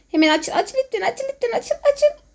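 frantic begging, voice climbing; being chased. Real, not acted. Begging door to open while chased.

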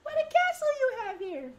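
high falsetto queen. Acted. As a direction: speak as a gracious queen. Voicing character in cutscene.

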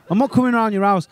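usual speaking voice

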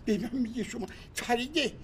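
ordinary talk

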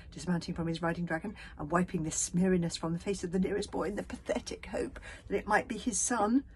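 ordinary delivery

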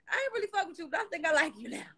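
high-pitched mock fan voice; imitating fans. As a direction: quote the converted fans up high. Quoting girls who warmed to her.

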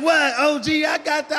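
put-on high, startled kid voice; imitating the kid. Caught boy answering the angry dad, high startled. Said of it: "He was like".

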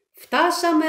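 loud high call of arrival; driver shouting. A narrator's reading. Bus driver calls out to the students, loud. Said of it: "φώναξε ο οδηγός".

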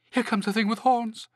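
high storybook shout of warning; shouting rabbit. A narrator's reading. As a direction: sound the alarm to everyone. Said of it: "shouted a rabbit".

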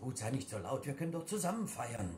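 hushed pleading. Acted. Father hushing his angry partner, soft pleading. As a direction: keep it down so the others won't hear.

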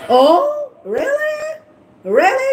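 high-pitched, incredulous questioning; mock surprise. Real, not acted. Streamer answering a claim about who was targeted, mock surprise. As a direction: feign disbelief, pitch leaping up.

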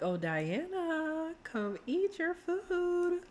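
voicing Tamil calling Diana to breakfast, slow and high; sweet caretaker voice. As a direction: call her to eat like a doting partner. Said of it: "Be like".

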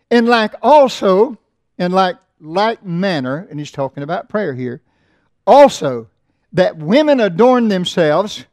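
loud, slow, ringing pulpit reading; preaching the text at full voice; scripture reading. Acted. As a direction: proclaim the verse to the congregation. Scripture read slowly.

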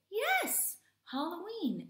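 very high, loud yes; excited praise. Acted. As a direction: cheer like a delighted teacher. Praising correct answer.

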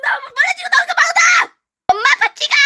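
high, loud excited calls; excited shouting. Real, not acted. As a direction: shout proudly to mom. (엄마에게 신나서 외치기)

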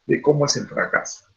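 ordinary talk

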